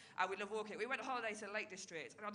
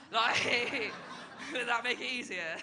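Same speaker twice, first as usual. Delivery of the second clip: laughing; 4 semitones higher, 11 dB louder, slower than usual. Real, not acted. Laughs at own joke.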